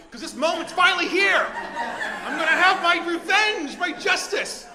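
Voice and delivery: high, loud cry of triumph; excited. Retold or imitated. Avenger exulting that the moment is here, excited and loud. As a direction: relish the revenge at full volume. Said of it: "'cause I'm also excited".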